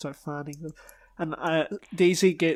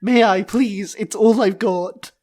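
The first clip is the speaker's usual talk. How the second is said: loud mock begging; pleading; 3 semitones higher, 7 dB louder than usual. Cleaner begging the princess to continue, pleading. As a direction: plead loudly in character. Daisy begs to keep cleaning.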